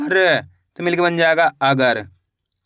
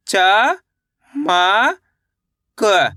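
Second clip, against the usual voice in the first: each letter drawn out, with a long pause after it; stretched, separated syllables; much slower than usual. Shown as a demonstration. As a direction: sound out the letters one at a time for learners. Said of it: "मेरे साथ साथ इनको repeat".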